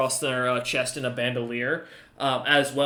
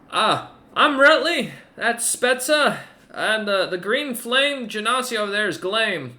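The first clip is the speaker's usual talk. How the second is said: high, loud ogre voice, wary and polite; ogre's voice; 8 semitones higher, 4 dB louder than usual. Acted. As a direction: speak down from the wall, wary but polite. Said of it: "nods and goes".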